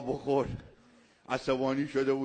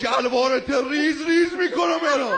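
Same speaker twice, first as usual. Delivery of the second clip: a higher, louder joking threat with laughter; mock shouted threat; 9 semitones higher, 7 dB louder than usual. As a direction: yell a playful threat. (فریاد تهدید شوخی با خنده)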